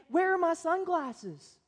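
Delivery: a put-upon wife asking for help; pleading wife. Retold or imitated. Wife asking laughing husband, slow and plaintive.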